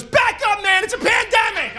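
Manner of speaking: high barked warning, back up man; playing the freaked-out shopper yelling; worked-up stranger shouting; mock angry yell. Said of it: "This dude flips out".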